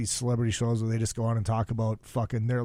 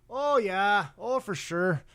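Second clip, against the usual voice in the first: high-pitched mock Minnesotan accent; 7 semitones higher, slower than usual. Mimicking locals back home, mock Minnesotan accent. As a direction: imitate a folksy Minnesotan accent. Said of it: "those fucking accents".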